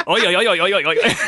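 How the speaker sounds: springy fake New Zealand babble; bad New Zealand accent. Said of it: "offended by what I did".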